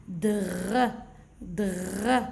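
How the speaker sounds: "Dr" with a long throaty R; blended consonants. Shown as a demonstration. Teacher reading syllables for children, blended consonants. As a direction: sound out "Dr" as one blend. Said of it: "regardez comment je vais lire".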